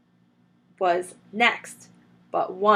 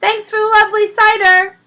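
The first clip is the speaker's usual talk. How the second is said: higher, loud cheeky farewell; calling out; 13 semitones higher, 12 dB louder than usual. A narrator's reading. The foxes call back to Rat as they escape, loud. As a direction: call it back over your shoulder. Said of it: "they called out".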